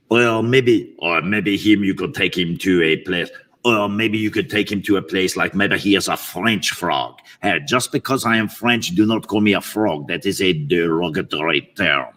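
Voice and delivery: put-on French-accented frog voice. French frog objecting to being called a frog, French accent. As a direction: protest with hammy French dignity. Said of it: "I'll do the second voice with him".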